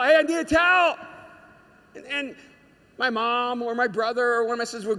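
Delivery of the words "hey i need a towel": loud, high call for a towel; yelling. Retold or imitated. Boy in the shower calling his family for a towel, yelling. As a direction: holler it out to the next room. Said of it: "I would always yell".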